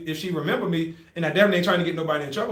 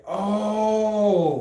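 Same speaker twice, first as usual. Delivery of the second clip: long, drawn-out "oh"; dawning realisation; 3 semitones higher than usual. Retold or imitated. Recruit getting bunkmate's sock tip, dawning realisation. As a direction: stretch it out as it clicks.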